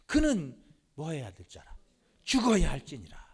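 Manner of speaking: 크게 꾸짖듯 읽는 성경 구절; 노한 왕처럼 호통치며 읽어라; 분노한 낭독. Acted. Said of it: "화를 내며 그에게 이르되". (요나단에게 호통치는 사울 왕, 큰 소리로)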